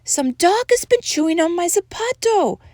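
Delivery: high little doggy voice; small amigo complaint. A narrator's reading. Second amigo complains in story.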